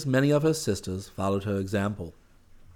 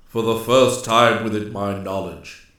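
lightly voiced forest ruler, resonant and kindly; grave but mild ruler's voice; gentle Master Woodsman; 6 dB louder than usual. A narrator's reading. Master Woodsman addresses the nymph who broke his law, gently. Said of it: "he said gently".

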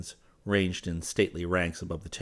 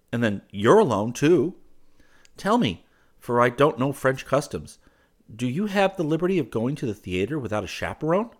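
higher, louder, lilting male character; pleasant young suitor; 6 semitones higher, 5 dB louder than usual. Acted. He questions a girl about French customs, pleasantly. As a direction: chat politely with lively rising pitch.

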